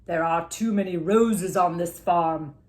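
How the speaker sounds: slow, loud stern warning; slow stern doctor. A narrator's reading. Doctor warning Robert off roses, slow and stern. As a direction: lay down the law slowly.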